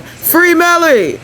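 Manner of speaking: high, drawn-out holler; mock scream. Vlogger imitating a courtroom heckler screaming support, mock holler.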